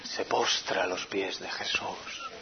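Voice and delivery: slow unvoiced whisper. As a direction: let it sink, whispered slowly. Repite la escena susurrando. (susurro lento y reverente)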